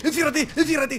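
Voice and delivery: high, frantic warning cries; urgent alarm. Real, not acted. Gamer warning partner as the bear comes, high urgent alarm. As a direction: urge them to shut themselves in now.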